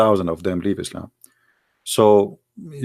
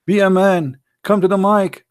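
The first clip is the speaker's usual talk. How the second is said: high-pitched, slightly louder call-out; mock challenge from the chat admin; challenging admin; 10 semitones higher, 4 dB louder than usual. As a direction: dare him to step up to the mic. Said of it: "he said".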